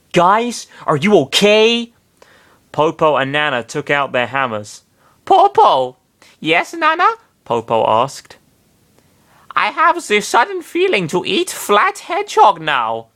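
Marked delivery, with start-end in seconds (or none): 0.1-1.9 higher, uneasy question. Acted. Sonic asking the glaring group, higher and uneasy. 5.2-6.0 put-on much higher, louder child call. Nana calling to Popo, much higher and louder. 6.4-7.1 put-on much higher childlike reply. Popo answering Nana, much higher. 9.6-13.1 much higher, hammed-up German accent; faux German accent. Nana craving hedgehog, in a mock German accent. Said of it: "faux Teutonic accent".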